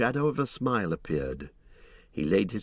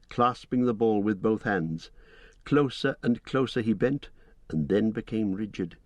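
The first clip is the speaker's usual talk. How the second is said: plain reading voice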